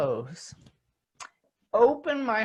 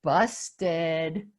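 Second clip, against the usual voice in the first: playful busted; 4 semitones lower than usual. Retold or imitated. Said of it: "he went like this".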